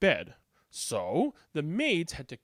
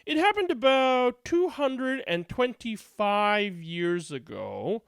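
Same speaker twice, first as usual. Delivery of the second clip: ordinary delivery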